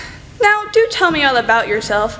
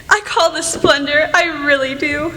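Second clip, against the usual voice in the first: usual speaking voice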